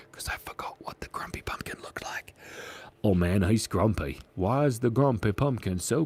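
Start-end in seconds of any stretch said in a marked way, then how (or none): 0.1-2.3 breathy whisper, no voice. Real, not acted. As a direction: whisper it like a secret. Confessing aside.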